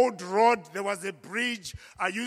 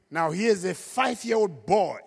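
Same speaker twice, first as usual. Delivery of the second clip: usual speaking voice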